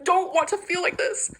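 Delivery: slow, high, tearful plea; crying it out slowly. Real, not acted. Still crying.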